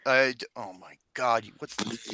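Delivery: usual speaking voice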